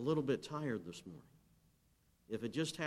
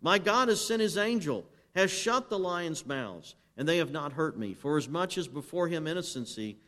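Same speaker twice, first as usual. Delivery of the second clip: loud, higher scripture proclamation; full-force preaching; 5 semitones higher, 8 dB louder than usual. Acted. Preacher reading Daniel in the lions' den to the congregation, loud ringing proclamation. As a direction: cry the king's and Daniel's words out to the church.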